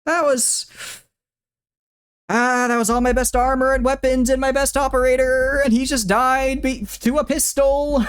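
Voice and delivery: high, loud wailing groans; real anguish. Real, not acted. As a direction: wail over the lost gear. Lamenting lost armor and weapons.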